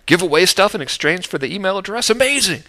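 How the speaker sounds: sarcastic fake wonder rising to high Amazing; mock amazement. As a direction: ham up the shock at an old idea. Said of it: "It's not like".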